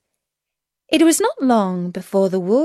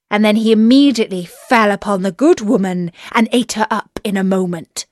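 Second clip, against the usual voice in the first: usual speaking voice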